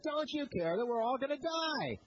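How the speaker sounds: a retold plea from men afraid of drowning; panicked scream. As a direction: cry out like frightened sailors over the wind. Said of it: "They're probably screaming at him over the howling wind".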